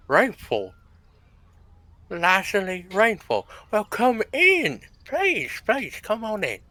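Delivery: high, loud, excited host; thrilled, drawn-out welcome in character; delighted welcome. Acted. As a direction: gush over the guest and usher them in. Host acts delighted at guest's name.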